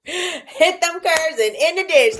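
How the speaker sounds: high, slow, laughing through the words; laughing repeat. Real, not acted. Vlogger laughs, high and slow, about cars in the ditch. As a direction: still laughing at the drivers.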